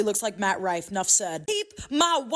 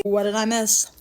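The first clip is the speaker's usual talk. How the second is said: ordinary talk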